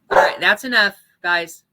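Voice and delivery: loud firm that's enough; a firm, loud telling-off to the dogs; scolding the dogs. Real, not acted. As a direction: call off the barking dogs.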